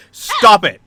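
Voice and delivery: sharp, loud, high stop it; angry fairy shout. Acted. Angry fairy snapping at Finn after he pokes her. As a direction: yell it right in his face. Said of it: "an angry face and flies straight up".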